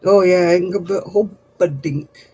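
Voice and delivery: usual speaking voice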